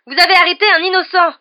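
high, full-voiced furious protest; yelling. A narrator's reading. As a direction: yell it at the minister. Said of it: "hurla Harry". (hurlement indigné)

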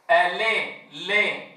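ألي with the lam vowel between a and i; teacher demo of a tilted kasra. Shown as a demonstration. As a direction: say لي with the vowel leaning toward fatha. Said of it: "هذا صوت كسرة أم صوت فتحة".

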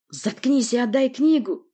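loud, high, angry bark; forceful, angry demand; barked order. Acted. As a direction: bark it out, drawing the words. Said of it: "рявкнул Порти".

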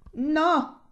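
high, drawn-out cry; cried refusal. A narrator's reading.

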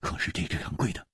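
usual speaking voice

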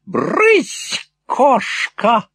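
put-on loud mad old gentleman; loud resounding shout. A narrator's reading. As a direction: shoo a cat away at full voice. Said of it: "громким и звучным голосом".

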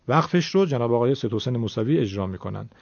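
normal talking voice

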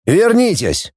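high-pitched call back; commanding call, no extra volume; barked order. A narrator's reading. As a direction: order them back sharply. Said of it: "гаркнул Середа".

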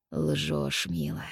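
groaned. A narrator's reading. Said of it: "простонал Кассандр".

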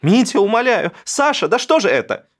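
high, loud cry to stop the fight; mother screaming at fighting sons; screaming plea. A narrator's reading. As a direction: scream at the brawling brothers to stop. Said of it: "закричала на Драчунов".

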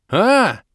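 high loud cry of alarm; startled yell. Acted. Hero yelling at the eared head rising from the river, loud and high. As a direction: yell out in sudden fright. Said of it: "завопил я".

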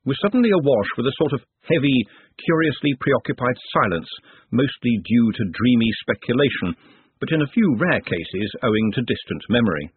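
plain reading voice

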